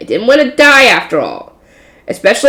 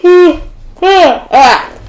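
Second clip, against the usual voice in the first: yawning mid-sentence; a long sighing yawn; big yawn; 8 semitones higher, 3 dB louder than usual. Real, not acted. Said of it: "If you hear me yawning a lot".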